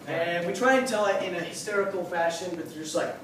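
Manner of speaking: normal talking voice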